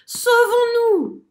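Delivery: cri paniqué très aigu et fort, lent; panique. A narrator's reading. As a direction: fuir en criant. Fleeing the lion. (merle paniqué qui crie)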